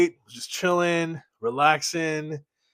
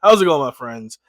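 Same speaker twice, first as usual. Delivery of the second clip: usual speaking voice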